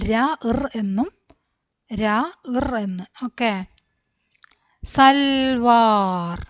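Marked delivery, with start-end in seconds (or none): 4.8-6.4 very slow, held "l" and long vowel; drawn-out reading. Shown as a demonstration. Teacher recapping the word for learners, drawn out. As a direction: stretch the whole word out.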